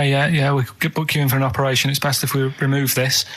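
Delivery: ordinary talk, nothing marked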